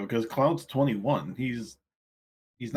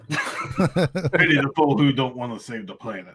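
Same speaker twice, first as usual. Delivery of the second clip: slow, lightly voiced tough-guy parody; Mister T; 3 semitones higher than usual. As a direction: draw out the catchphrase in a joking impersonation. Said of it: "Mister T".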